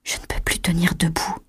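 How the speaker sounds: very soft, half-whispered; weak murmur. A narrator's reading. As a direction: murmur it as if too weak to stand. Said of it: "murmura Chloé". (murmure faible, presque chuchoté)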